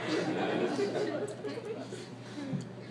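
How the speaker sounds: high, soft giggle; amused high chuckle; high laugh. Real, not acted. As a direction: let a light laugh go. Laughing after punchline.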